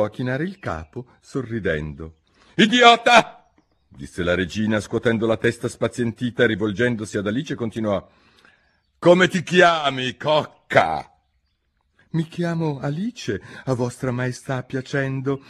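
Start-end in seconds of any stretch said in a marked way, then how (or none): 2.6-3.5 high, loud contemptuous snap; scornful insult. Acted. La regina insulta il fante, sprezzante. Queen scolds the knave. 9.0-11.1 high, loud and slow, condescending; haughty queen. Acted. La regina chiede il nome ad Alice, altezzosa. 12.1-15.4 put-on polite girl. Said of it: "con la dovuta compitezza".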